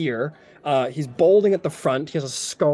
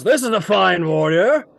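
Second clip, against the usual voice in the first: higher, louder approval; boisterous warrior's praise; hearty knight character; 4 semitones higher, 5 dB louder than usual. Acted. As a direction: admire the fighter mid-handshake. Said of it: "He goes".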